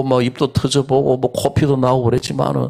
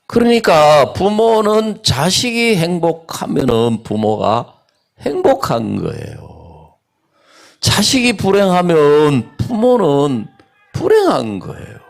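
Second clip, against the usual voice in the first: preaching; 4 semitones higher than usual. Acted. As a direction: preach it, slowing for weight. Preaching climax. (설교하듯 천천히 고조되며)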